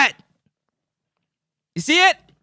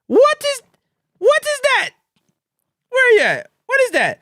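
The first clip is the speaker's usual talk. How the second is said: high-pitched mock disbelief; astonished; 11 semitones higher than usual. Host reacts to the stadium field, high mock astonishment. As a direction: gape at it with shrieky questions.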